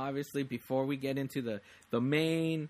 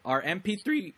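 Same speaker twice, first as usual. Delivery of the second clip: a real crack, pitch jumps on "three"; 5 semitones higher, 4 dB louder than usual. Real, not acted. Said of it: "my voice cracked".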